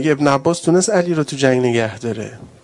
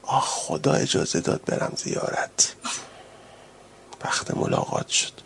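hushed, much lower whisper; 10 semitones lower, 9 dB quieter than usual. Acted. Ali waiting for the call to prayer, whispered. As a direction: whisper it like a longed-for secret.

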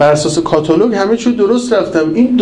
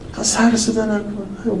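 mock hush; 4 semitones higher, 7 dB quieter, slower than usual. Hushing noisy pilgrims.

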